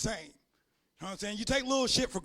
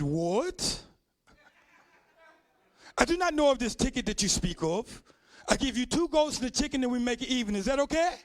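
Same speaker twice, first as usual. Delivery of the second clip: mock African accent. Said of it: "straight-ass face".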